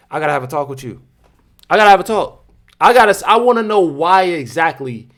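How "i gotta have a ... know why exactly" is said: loud, high-pitched incredulous demand; exasperated demand. Real, not acted. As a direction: demand an explanation at full volume. Demands explanation from the voters.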